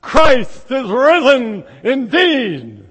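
slow, loud answering shout; crowd's response. Acted. Seven thousand answering the priest, loud. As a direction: answer back with full force. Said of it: "the response".

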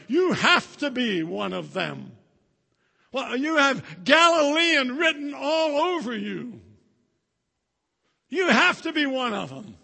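high, loud insistence; crowd accusing. Acted. Bystanders confronting Peter about his accent, loud insistent. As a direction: pin him down together.

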